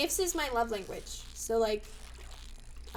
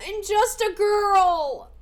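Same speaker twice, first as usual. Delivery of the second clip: mock helpless; 7 semitones higher, 8 dB louder, much slower than usual. Slow mock plea.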